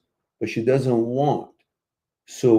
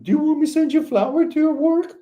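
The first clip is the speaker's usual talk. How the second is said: much higher and fast, a hammed-up hesitant boyfriend; mock timid asking; 14 semitones higher, 3 dB louder, faster than usual. Host mocks a man asking permission to send flowers, high and fast. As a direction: ask it nervously, high and hurried. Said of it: "You don't have to ask a woman".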